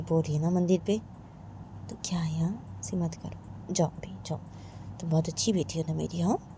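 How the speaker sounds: soft, hushed motherly coaxing, partly whispered; quiet pleading mother. Acted. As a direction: plead quietly so only the daughter hears.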